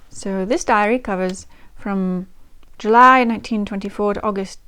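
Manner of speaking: put-on amateur West London English accent; Vivian. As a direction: read the diary in character, in your best West London accent. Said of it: "West London accent".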